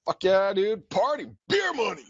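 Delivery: high, whooping party cheer; young party excitement. Retold or imitated. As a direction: cheer like a broke kid with cash. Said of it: "I was like".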